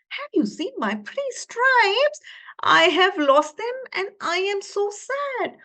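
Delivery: high, sad little zebra. Acted. Zebra tells tiger she lost her stripes, high and sad. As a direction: ask for the lost stripes with a mock sad plea. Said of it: "she went to the tiger".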